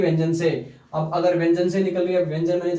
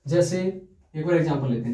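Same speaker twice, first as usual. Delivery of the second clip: ordinary delivery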